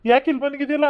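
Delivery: startled to find him hiding here; surprised call. Acted.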